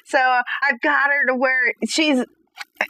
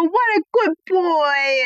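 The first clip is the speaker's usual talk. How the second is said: praising puppy; 5 semitones higher, slower than usual. Retold or imitated. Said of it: "And then I'll go".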